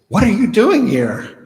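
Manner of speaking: high, loud startled cry of disbelief; shocked outburst. Acted. Woman startled to find her ex-husband arriving at heaven's gate, loud shocked cry. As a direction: blurt it out, shocked to see him there.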